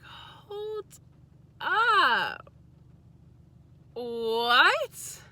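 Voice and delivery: high, stretched hold up, then a loud what; stunned, drag it out then snap; drawn-out disbelief. Retold or imitated. Retelling her boyfriend, a high stunned what.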